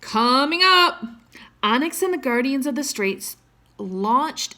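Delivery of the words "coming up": called out. A narrator's reading. Dean calling to crew.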